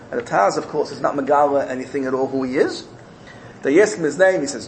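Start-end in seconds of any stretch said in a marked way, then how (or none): none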